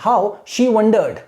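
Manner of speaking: How, then a short pause, then she wondered; How with a brief pause after. Shown as a demonstration. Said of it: "थोड़ा सा रुकना".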